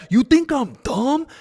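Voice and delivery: high, slow sneering "You think I'm dumb?" with a rising end; mocking Aspinall. Streamer repeats Aspinall's line to show how he said it, high and slow. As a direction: stretch "dumb" up into a smug question. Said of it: "He said it like that".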